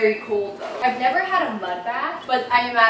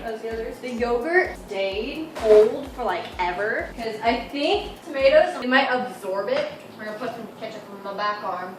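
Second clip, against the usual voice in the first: ordinary talk, nothing marked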